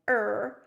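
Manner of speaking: loud drawn-out creaking "Er"; crying creak. Shown as a demonstration. Teacher demonstrating the crying tree sound, a loud creaking "Er". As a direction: groan "Er" like a creaking, crying branch. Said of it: "So it would sound like this".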